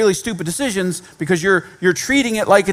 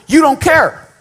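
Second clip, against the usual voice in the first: higher, louder, stressed-out complaint; frantic Martha; 5 semitones higher, 4 dB louder than usual. Acted. Martha complaining to Jesus, higher and louder, frantic. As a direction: voice an overwhelmed, accusing Martha. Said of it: "her instinct was to go".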